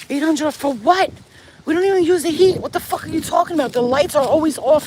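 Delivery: loud, fast, high outraged disbelief; yelling in angry disbelief at the bill. Real, not acted. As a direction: blow up at the bill. Shocked by eight hundred dollar bill.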